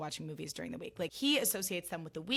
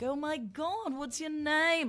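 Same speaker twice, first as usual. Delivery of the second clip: excited celebrity; 9 semitones higher, slower than usual. Retold or imitated. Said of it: "And he was like".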